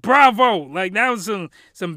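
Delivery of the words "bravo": loud excited bravo; excited cheer. Retold or imitated. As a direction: shout it with delight. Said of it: "So I was like".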